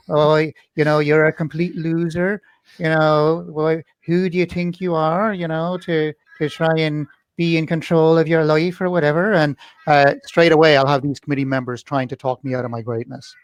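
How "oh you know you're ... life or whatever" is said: light Irish accent, mocking inner critic; Veteran Limbic. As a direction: needle yourself in an Irish lilt. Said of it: "I don't know why he's Irish".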